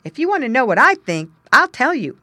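loud, firm refusal; firm declaration. Acted. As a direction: stand your ground loudly. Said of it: "he declared".

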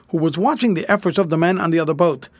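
ordinary delivery

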